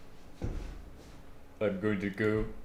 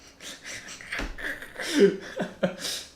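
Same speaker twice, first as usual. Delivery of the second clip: high laugh; 3 dB louder than usual. Real, not acted. Laughing at the AI's reply.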